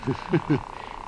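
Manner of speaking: breathy, scornful soft laugh; a short mocking huff of laughter; bitter laugh. Doctor scoffs at her claim.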